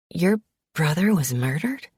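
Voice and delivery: ordinary delivery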